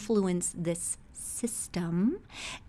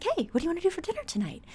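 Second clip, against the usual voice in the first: quick, much higher and chirpy, casual dinner question; breezy and carefree; 11 semitones higher, much faster than usual. Retold or imitated. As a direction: toss it off brightly, without a care. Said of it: "I come bopping into the house".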